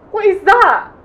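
high, loud startled cry; startled question. Acted. Pilot spotting the body on the ice, shocked cry. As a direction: blurt it out in shock.

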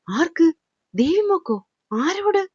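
disbelieving uncle asking who and whom; stunned questions. Acted. Uncle stunned by daughter's romance.

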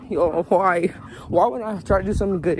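ordinary talk

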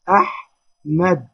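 slow أحمد, resting on the ح; stop on ح. Shown as a demonstration. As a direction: say أحمد as "aḥḥ - mad". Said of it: "وقفنا على الحاء".

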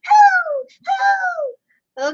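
high shouted hoo cries. Pretend. Comedian doing [name] hoots, high and loud.

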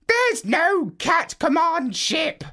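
a calm, high parrot giving advice. Acted. Parrot tells Marlowe to remove goggles, high bird voice. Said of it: "said the parrot".

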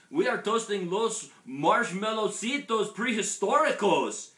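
high, loud storybook Chihuahua call; hollering. A narrator's reading. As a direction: holler it proudly to a friend below. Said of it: "hollered the Chihuahuas".

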